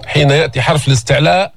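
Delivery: ordinary talk, nothing marked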